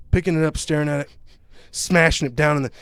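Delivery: ordinary talk, nothing marked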